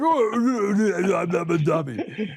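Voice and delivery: lightly put-on whiny protest; mock protest. Host imitating an offended coworker denying a resemblance, mock protest. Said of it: "Matt be like".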